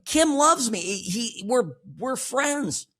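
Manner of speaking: high, slowing mock Trump, drawn out on friends; Trump impression. Host mimics Trump claiming Kim loves him, high and drawn out. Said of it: "He's like".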